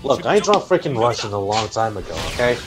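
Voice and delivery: usual speaking voice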